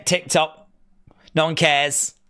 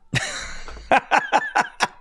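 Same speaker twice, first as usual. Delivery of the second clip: squealing laugh, well above the usual voice; high burst of laughter. Real, not acted. Streamer laughing hard after being tagged.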